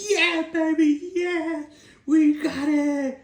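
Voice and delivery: put-on very high, slow, loud yeah baby; drawn-out squeal of triumph; dad celebrating with his baby, very high; ecstatic cheer.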